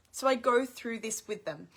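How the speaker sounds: normal talking voice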